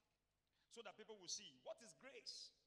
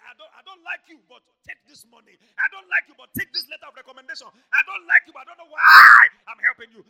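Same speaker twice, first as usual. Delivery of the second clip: mock protest building to a shout; exasperated reluctant helper; 9 semitones higher, 44 dB louder than usual. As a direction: imitate grudging helpers, high and loud.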